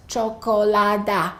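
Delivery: 'chocolada' with each vowel fully sounded; every vowel pronounced. Shown as a demonstration. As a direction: say 'chocolada' as cho-ko-la-da, four full syllables. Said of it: "and in some languages, it's".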